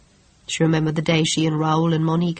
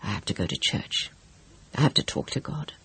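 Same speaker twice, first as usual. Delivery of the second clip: low, soft, hoarse voice; worn out and hoarse; hoarsely; 5 semitones lower, 8 dB quieter than usual. Acted. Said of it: "Teresa said hoarsely".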